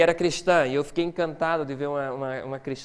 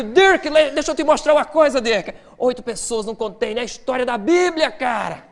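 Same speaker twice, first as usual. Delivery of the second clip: much higher and louder, fast and eager; excited; 8 semitones higher, 7 dB louder than usual. Retold or imitated. Scientist rushing to show colleague Dirk, overexcited. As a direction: burst in bursting with a discovery. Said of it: "eu todo empolgado".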